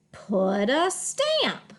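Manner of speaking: slow, connected "Put a stamp"; drawn-out teacher read of "Put a stamp"; smooth phrase reading. Shown as a demonstration. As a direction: glide through "Put a stamp" as one phrase. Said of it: "read smoothly in phrases".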